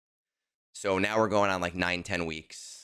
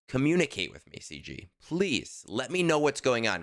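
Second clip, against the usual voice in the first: urgent plea; 7 semitones higher than usual. Real, not acted. Direct plea to game studio.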